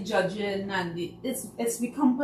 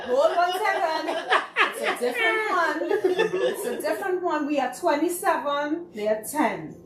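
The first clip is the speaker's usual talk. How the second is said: higher, laughing protest that it's another group; laughing correction; 8 semitones higher, 4 dB louder than usual. Retold or imitated. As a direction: correct the fan through laughter, pitched up.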